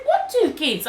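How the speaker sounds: high-pitched incredulous question; retold disbelief. Accused woman asking about children, retold incredulity. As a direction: re-enact bafflement, pitched right up.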